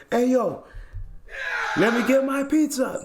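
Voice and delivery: brash hyped-up yell; mock demand. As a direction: holler for the pizza back. Neighbor demanding pizza back.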